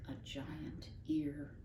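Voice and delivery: hushed, slow whisper; a giant ear, whispered slowly. A narrator's reading. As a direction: breathe it like a secret. Reading about listening intently.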